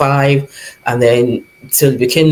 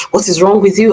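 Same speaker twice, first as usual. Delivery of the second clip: retold shout; 6 semitones higher, faster than usual. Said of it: "started shouting".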